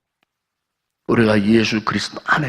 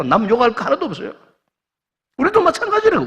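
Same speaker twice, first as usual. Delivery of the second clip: high, ringing exhortation pushed from the pulpit; preaching at full force; 14 semitones higher, 5 dB louder than usual. Acted. Pastor warning the congregation, preaching at full force. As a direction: drive the warning home to the pews.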